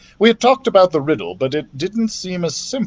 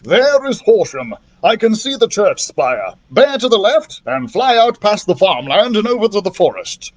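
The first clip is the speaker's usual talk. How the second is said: higher, eager calling out of directions; excited cry; 6 semitones higher than usual. Acted. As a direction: point out the landmarks, calling ahead. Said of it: "cried Doctor Drake".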